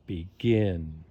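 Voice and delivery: "begin" with the weight on "gin"; stress on second syllable. Shown as a demonstration. Teacher showing word stress to learners, "begin" stressed on "gin". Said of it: "second syllable stressed".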